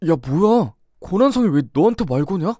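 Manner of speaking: normal talking voice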